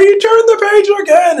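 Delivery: high, squealing monster glee; excited Grover. Retold or imitated. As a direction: squeal it like a thrilled puppet monster. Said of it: "He gets all excited about it".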